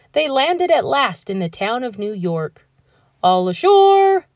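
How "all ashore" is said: high, loud call; sailors' shout. A narrator's reading. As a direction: call it out across the deck. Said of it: "the men shouted".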